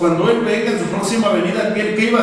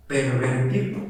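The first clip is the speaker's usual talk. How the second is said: ordinary talk, nothing marked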